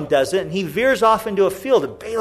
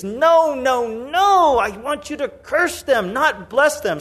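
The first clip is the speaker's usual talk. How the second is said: high, acted outrage; hammed-up furious king; furious protest; 6 semitones higher, slower than usual. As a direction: protest indignantly, pitched well up. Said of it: "And Balak is furious".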